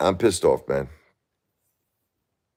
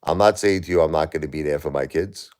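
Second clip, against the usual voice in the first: ordinary talk, nothing marked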